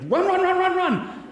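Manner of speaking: screaming run at the top of his lungs; panicked yell to flee; run run shout. Retold or imitated. Friend fleeing elephant yells to run, panicked shout. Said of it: "And he's like".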